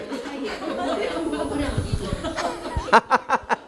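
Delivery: high-pitched drawn-out laughter; long laugh. Real, not acted. Lecturer bantering with students about his mask, long high laugh. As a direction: laugh at length, pitched well up.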